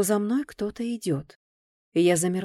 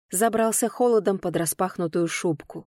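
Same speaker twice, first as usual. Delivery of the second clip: plain reading voice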